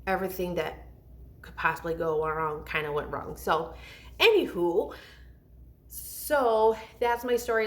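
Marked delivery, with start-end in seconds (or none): 4.2-7.7 sing-song anywho. Real, not acted. Sing-song topic shift.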